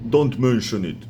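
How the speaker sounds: gruff creature voice for the movie; Rhino character voice. Shown as a demonstration. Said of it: "and I record".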